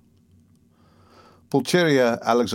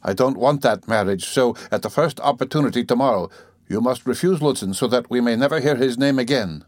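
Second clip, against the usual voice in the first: ordinary delivery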